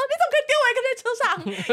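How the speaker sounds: abandoned sister, squealing in hammed-up despair; panicked sister. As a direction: whine it out in comic panic. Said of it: "然后我姐就也崩溃".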